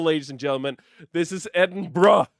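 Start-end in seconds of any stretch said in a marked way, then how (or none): 1.1-2.3 Edinburgh said ED-en-bra, loud and laughing; bra ending. Shown as a demonstration. Streamer presenting Google's pronunciation to chat, loud bra ending. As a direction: announce Edinburgh with a bra at the end. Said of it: "Just use the bra effect every time at the end".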